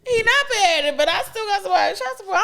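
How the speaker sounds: high, quick sassy comeback; sassy defiance. Real, not acted. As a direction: brag it back with attitude.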